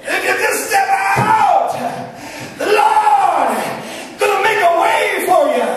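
high, drawn-out exhorting cry; camp meeting shout. Retold or imitated. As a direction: belt it out high to a whole crowd.

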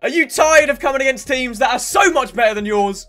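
loud, punchy promo delivery; hard-sell ad read. Acted. YouTuber plugging his coin sponsor, hard-sell ad read. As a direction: shout the pitch like a hard-sell commercial.

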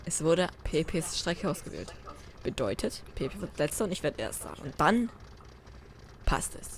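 soft conspiratorial whisper. Acted. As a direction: whisper a secret to the viewers. Whispered aside to viewers.

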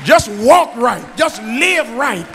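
loud, high preaching cadence; preaching at full force. Acted. Pastor encouraging childless women, preaching loud. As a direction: pour it out over the pews.

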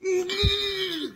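high, loud burst of laughter; laughing shout. Real, not acted. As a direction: crack up mid-wail.

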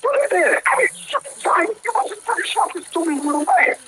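high-pitched exasperated rant; real gamer frustration; angry. Real, not acted. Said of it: "He's so angry".

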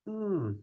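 soft, interested hmm; a gentle hum that says go on; listening hum. Shown as a demonstration. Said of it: "Just a little sound like that".

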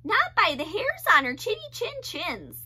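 high squeaky pig voices; crying pigs. Acted. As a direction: cry out defiantly. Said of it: "the pigs replied".

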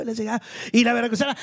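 ordinary talk

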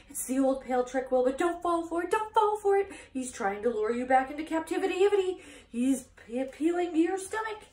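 high, loud honking warning; urgent goose. A narrator's reading. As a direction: honk warnings in a rush. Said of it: "said the goose".

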